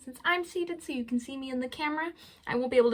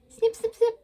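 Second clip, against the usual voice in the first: much higher, louder snips; snipping sound; 11 semitones higher, 4 dB louder than usual. Pretend. Librarian repeating the crab sound, higher. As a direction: snap out snips like pincers. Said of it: "crab is what I found".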